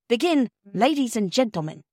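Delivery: ordinary delivery, nothing marked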